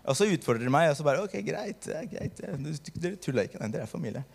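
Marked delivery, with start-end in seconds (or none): 1.2-4.2 subdued giving in. Retold or imitated. Gives in to challenging friends.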